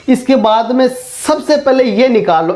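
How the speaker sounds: ordinary talk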